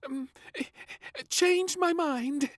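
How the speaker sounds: high, slow, nervous stammer; a high, halting excuse; stuttering. A narrator's reading. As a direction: back out nervously. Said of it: "he stuttered".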